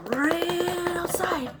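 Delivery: slow, lifted ran outside; slow running. Shown as a demonstration. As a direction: draw it out like running in slow motion. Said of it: "so we're gonna run".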